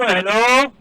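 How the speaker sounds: loud, carrying hello down a bad phone line; calling out loud. Real, not acted. Host calling out to a caller he can't hear, loudly. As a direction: call out so the caller hears you.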